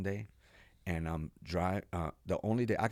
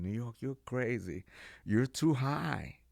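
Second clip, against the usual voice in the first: high, slowed teasing voice; mock mocking friends; 6 semitones higher, slower than usual. Friends mocking him for being high, teasing and high. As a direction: laugh it off, teasing.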